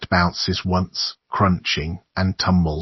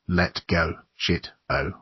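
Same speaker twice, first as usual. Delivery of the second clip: gasping in pain; 5 dB quieter than usual. A narrator's reading. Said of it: "he gasps".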